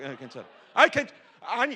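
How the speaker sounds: normal talking voice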